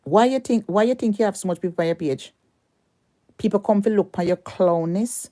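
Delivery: mock her follower count; angry taunt. Real, not acted. Angry rant at a rival.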